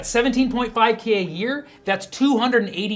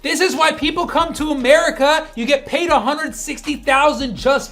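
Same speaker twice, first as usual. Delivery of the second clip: excited outburst; 7 semitones higher, 4 dB louder than usual. Acted.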